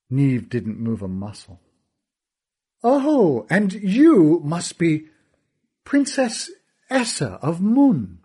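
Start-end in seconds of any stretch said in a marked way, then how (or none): 2.8-8.2 much higher, slow, silky menace; smug villain. Acted. Kealty lectures about trusting fathers, slow silky smugness. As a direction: purr the lesson with a smile.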